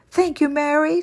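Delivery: high, bright thankful cry; thanking her rescuer with a high, grateful cry. Acted. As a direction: cry out your thanks, relieved. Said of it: "cried Amelia".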